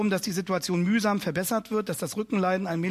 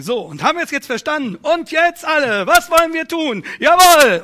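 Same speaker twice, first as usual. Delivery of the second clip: loud, high rallying cry building to a shout; mock rabble-rouser; 8 semitones higher, 12 dB louder than usual. Preacher parodies a rabble-rouser whipping up the congregation, loud mock yell. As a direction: whip up the crowd with a mock cheerleader yell. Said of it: "dann wäre ich ein Einpeitscher".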